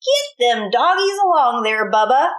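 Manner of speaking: a hint of a bossy cowhand giving orders; lightly voiced lazy brother barking orders; bossy stepbrother. Stepbrother bossing Bubba around on the ranch, mildly bossy. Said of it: "ordered Dwayne".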